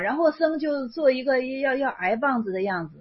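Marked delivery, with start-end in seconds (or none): none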